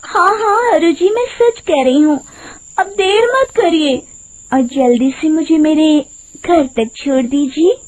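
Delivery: high, coaxing female character voice; sweet-talking woman. Acted. Disguised witch asking the driver for a ride, coaxing. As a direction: urge him sweetly in a high voice.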